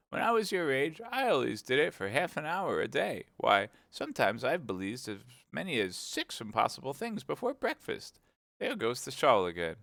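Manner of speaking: a hint of a scatterbrained queen. A narrator's reading. The Queen explaining to Alice, lightly voiced fussy queen.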